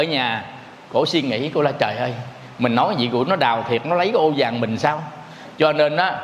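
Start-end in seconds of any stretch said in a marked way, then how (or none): 1.8-5.0 low, fast anxious muttering to herself; old mother frets that her son will dig up the gold; worried mother. Retold or imitated. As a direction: lower and quicker, fretting alone.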